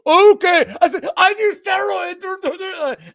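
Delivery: very high, loud, drawn-out taunt; mock him in an exaggerated yelling voice; mocking imitation. Manager mocking the steroid monkey, loud and high-pitched. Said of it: "Now I'm, like, mocking him".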